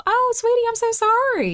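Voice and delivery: high-pitched, cooing sympathy; gushing sympathy. Acted. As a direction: coo it high, as if pitying a sweetie.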